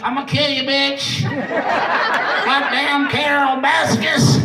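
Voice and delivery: hammed-up impression of a ranting cowboy; Joe Exotic. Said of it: "That's a pretty good impression".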